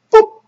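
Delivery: sudden high loud boop; comic boop sound. Retold or imitated. Mother shoving candy at the crying child, comic high boop. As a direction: pop it out like a cartoon sound effect.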